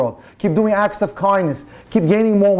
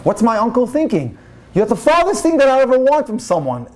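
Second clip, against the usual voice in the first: higher, incredulous put-down; indignant; 7 semitones higher than usual. Retold or imitated. Sarah dismissing the scruffy date, indignant and higher. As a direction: brush him off in disbelief.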